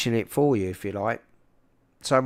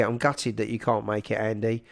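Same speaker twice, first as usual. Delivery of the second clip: ordinary delivery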